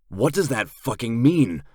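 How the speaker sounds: panicked narrator demanding the truth. A narrator's reading. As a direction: push hard for the answer. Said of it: "I say a bit louder".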